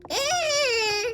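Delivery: high, loud held cry; fight scream. Pretend. Owl child in a fight, high yell. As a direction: let out a long yell.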